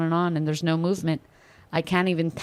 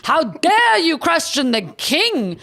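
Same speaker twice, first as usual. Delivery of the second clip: slow, high, scandalized sneer; sarcastic imitation of offended loyalists; mock outraged crowd; 5 semitones higher, 3 dB louder, slower than usual. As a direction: ham up their shocked indignation. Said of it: "Everybody's like".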